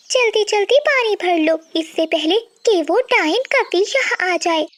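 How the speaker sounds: high-pitched bird neighbour, urging the others; high, feisty bird character; quarrelsome bird, griping. Acted. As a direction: gripe about a rival in a high voice. Voices Priya gossiping at river.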